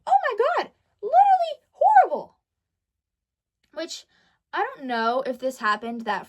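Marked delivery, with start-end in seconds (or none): 0.0-2.4 high, loud, almost crying complaint; exasperated wail. Real, not acted. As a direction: wail it out, close to tears. Venting about the worst class period.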